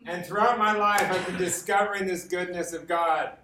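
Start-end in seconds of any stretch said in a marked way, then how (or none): none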